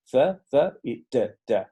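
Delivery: slow, isolated th and t sounds; sound demo. Shown as a demonstration. Teacher models the th and t sounds for the class. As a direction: drill the th and t sounds slowly, one at a time. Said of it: "los dos fonemas que vemos ahí".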